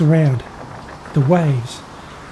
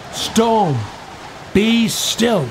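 higher, drawn-out command with pauses; measured, raised-pitch authority; solemn command; 5 semitones higher, much slower than usual. A narrator's reading. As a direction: command the storm word by word, without shouting. Jesus commands the storm.

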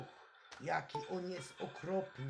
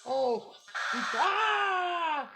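frightened shouting; 5 semitones higher, 6 dB louder than usual. Real, not acted. Game monsters jump out at night.

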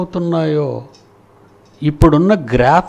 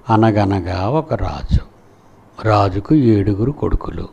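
slow, lower sing-song reading of a school lesson; schoolbook recitation; 6 semitones lower than usual. Retold or imitated. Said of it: "నేను చదువుకున్నానండి పాఠం". (పాఠం చదువుతున్నట్టు నెమ్మదిగా)

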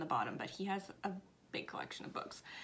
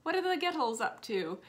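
higher-pitched, amateur Scottish grandma impression; bad Scottish accent; 5 semitones higher, 4 dB louder than usual. Grandma asking after her granddaughters in an imitated Scottish accent. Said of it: "I'm very bad at accents".

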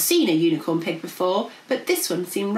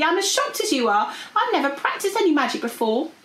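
higher-pitched, earnest dragon voice; shocked dragon; 9 semitones higher than usual. Acted. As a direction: insist you are just as shocked. Shocked dragon explains herself.

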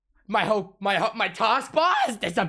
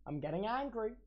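quietly seething; 13 dB quieter than usual. Real, not acted.

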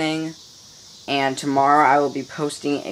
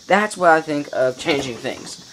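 ordinary talk, nothing marked

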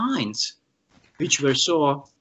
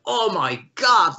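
awestruck; 3 semitones higher, slower than usual. Retold or imitated. His stunned reaction, retold.

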